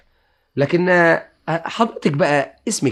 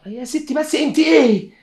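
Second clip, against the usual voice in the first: exasperated shout; 11 semitones higher, 3 dB louder than usual. Acted. Husband explodes at maid.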